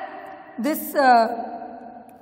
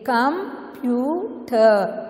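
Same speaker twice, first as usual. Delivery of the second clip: "Computer" very slowly as com, pyu, ter; three detached, unhurried beats on "Computer"; "Computer" divided to show easy pronunciation; syllable by syllable; 3 semitones lower, much slower than usual. Shown as a demonstration. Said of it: "you can divide and you can pronounce them".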